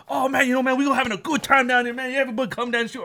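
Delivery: high, fast Louisiana cook impression; rapid, run-on Louisiana patter; Louisiana accent. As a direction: rattle it off in imitated Louisiana accent. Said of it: "That's a real accent down there".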